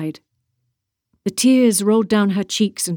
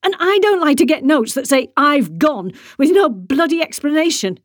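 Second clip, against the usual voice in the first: high, loud furious shout; angry scream; 7 semitones higher, 3 dB louder than usual. A narrator's reading. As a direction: lash out at the missing husband. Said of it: "she screamed".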